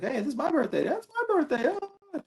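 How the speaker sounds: high-pitched playful back-and-forth; mock birthday exchange. Guest acting out him and his best friend comparing birthdays, high and playful. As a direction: squeak it out like two kids comparing birthdays. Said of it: "it's like".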